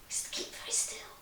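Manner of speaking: quick, hushed whisper after a gasp. Pretend. Telling children to keep very still in the wiggle song, whispered. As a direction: whisper it fast, as if freezing on the spot. Hushing children to freeze in game.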